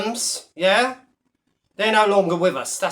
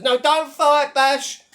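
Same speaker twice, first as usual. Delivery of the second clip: much higher, whiny mock plea; mocking do-gooder; 7 semitones higher, slower than usual. Mocking the do-gooders who beg Bash, in a high whiny plea. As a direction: squeak it out like a fretting do-gooder. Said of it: "being do-gooders".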